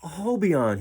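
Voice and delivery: calling the name out high and loud; crying out. A narrator's reading. Jollymon calling out to his returning dolphin friend, high and loud. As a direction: call out to the dolphin in joy. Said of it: "he cried".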